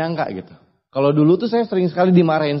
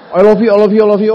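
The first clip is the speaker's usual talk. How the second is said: louder and higher, gushing; excited mother's spam; 6 semitones higher, 9 dB louder than usual. Retold or imitated. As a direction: pile it on like an overjoyed mom. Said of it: "saya di spam chat". (ucapan sayang ibu yang heboh dan keras)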